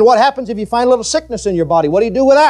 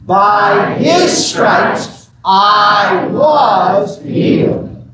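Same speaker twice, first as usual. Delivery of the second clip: slow congregational shout; much slower than usual. Shown as a demonstration. Preacher leading the congregation in a slow shouted confession. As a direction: belt it out slowly for the room to echo. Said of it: "Say it again".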